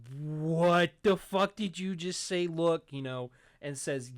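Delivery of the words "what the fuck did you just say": loud, slow, drawn-out astonishment; big-eyed disbelief. Retold or imitated. Vet hearing the snake sleeps in her bed, stunned. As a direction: act out a stunned vet, louder and higher. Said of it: "the vet gives this big-eyed, like".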